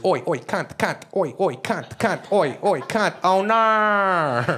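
mock the accent, chanting the oi's high and loud; Aussie chatter impression. Comic parodying the Australian street chatter, high and loud. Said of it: "All you hear is".

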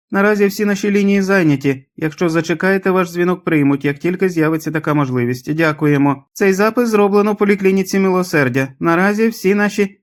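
high-pitched answering machine recording; piping, mechanical phone announcement; squeaky mechanical voice. A narrator's reading. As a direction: recite it squeakily, like an automated message. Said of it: "Заговорив по механічному писклявий голос".

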